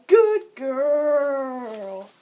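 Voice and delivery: loud, drawn-out pet praise; loud pet-owner voice, long drawn-out ending; praising a pet. Shown as a demonstration. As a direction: praise your pet, stretching out the last word. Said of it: "I say".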